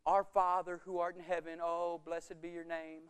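mock the proper religious prayer; lightly hammed-up pious prayer. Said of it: "the religious Jesus thing".

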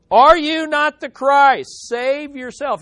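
loud, high, drawn-out jeer; hurling abuse. Acted. Criminal hurling abuse at Jesus beside him, jeering loudly. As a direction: hurl it as a mocking challenge. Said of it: "hurling abuse at him".

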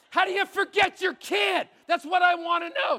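shouted high and loud, half joking; bitter outburst. Real, not acted. Pastor shouting to the congregation about being forgotten, bitter yell. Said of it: "Can you tell I'm still bitter?".

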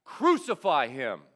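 loud shouted cry of the mob. A narrator's reading. Preacher voicing the mob shouting at Pilate, loud shout. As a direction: shout it back like an angry crowd. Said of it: "And the mob shouted back".

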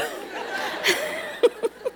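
high, breathy laugh; real drawn-out laugh. Real, not acted. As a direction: laugh at your own weepy impression. Laughs at her own crying impression.

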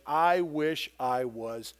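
low, slow, hollow despair; Isaiah slowly wishing he were dead; despairing prophet. Acted. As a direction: voice the prophet's wish to die. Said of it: "here's the exact translation".

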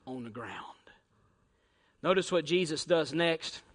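ordinary talk

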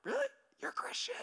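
high, soft incredulous questions; mock-stunned, under-the-breath reaction; hushed disbelief. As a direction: quietly squeak in disbelief. Acts God's disbelieving reaction.

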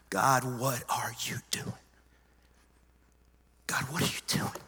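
hushed, whispery cry to God; strained screaming at God, held back. Retold or imitated. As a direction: whisper a scream of desperate questioning. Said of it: "I'm screaming in the truck".

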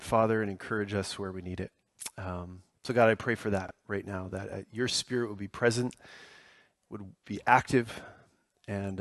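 low, hushed praying voice; spoken prayer. Real, not acted. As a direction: pray softly and earnestly, pausing between phrases. Leads a congregational prayer.

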